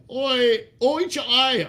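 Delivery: high, hammed-up cry of oi; mock shout. Reading the ad's Scottish cheer, a high mock shout. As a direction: call it out like a rowdy Scot, half in fun. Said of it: "have you shouting".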